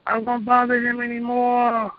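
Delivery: high, slow begging wail of a demon; pleading demon. Retold or imitated. Demon begging its Master not to punish it, high pleading wail. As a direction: beg your master for mercy, way up high. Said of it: "the demons was hollering".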